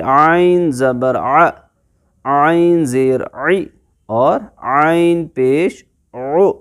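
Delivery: आइन ज़बर आ, आइन ज़ेर ई, आइन पेश उ stretched slowly from mid-throat; slow recitation demo. Shown as a demonstration. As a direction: chant each आइन vowel slowly. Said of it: "sound पर आप ध्यान दीजिए".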